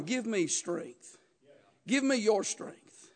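preacher quietly voicing God asking for strength, tender and slow; soft voice of God. Preacher voicing God's gentle plea, soft and slow.

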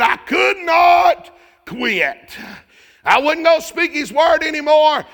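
slow thundering preacher's shout; preaching shout. Acted. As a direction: cry it out with full conviction. Jeremiah quote preached at full force.

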